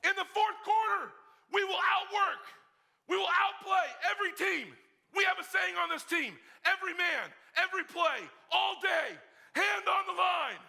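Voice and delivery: rally the team; rallying shout. Retold or imitated. Seniors rallying the team, shouting. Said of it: "seniors are saying".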